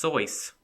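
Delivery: Seuss said as "soice", rhyming with voice; Soice pronunciation. Shown as a demonstration. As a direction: say Seuss with an "oy" vowel, like voice. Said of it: "is actually pronounced".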